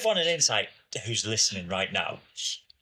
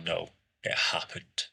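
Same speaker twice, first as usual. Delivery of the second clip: low, flat, breathy imitation of dad; monotone whisper; 7 semitones lower, 4 dB quieter than usual. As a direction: quietly state it as final. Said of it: "Like that".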